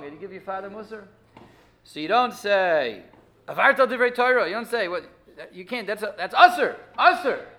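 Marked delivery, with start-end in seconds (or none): 3.5-7.5 put-on loud, harsh rebuke like scolding a child; loud scolding higher voice. Shown as a demonstration. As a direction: snap it like you're telling off a kid. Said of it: "The way you say it to your, your kids".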